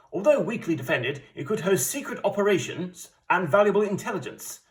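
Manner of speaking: lightly done 1940s British briefing voice; a hint of wartime British newsreader; 1940s British accent. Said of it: "in my best, nineteen forties British accent".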